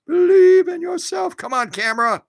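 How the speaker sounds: a sing-song pep talk to the camera; Believe said high and bright as mock encouragement; cheer-up voice.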